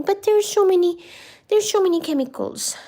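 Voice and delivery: high cooing. Real, not acted. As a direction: tell the cat sweetly, up high. Baby talk to the cat.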